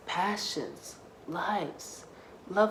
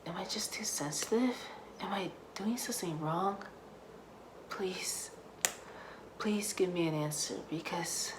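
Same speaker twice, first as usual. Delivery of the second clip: whispered plea; desperate whisper; 5 dB quieter than usual. Acted. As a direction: whisper it, pleading for answers. Desperate prayer, breaking down.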